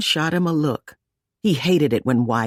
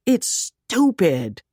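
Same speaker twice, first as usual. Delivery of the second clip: angry interruption; 6 semitones higher, slower than usual. A narrator's reading. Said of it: "Zoe interrupted angrily".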